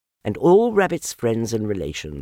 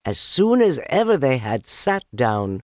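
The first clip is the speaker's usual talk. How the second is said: ordinary delivery, nothing marked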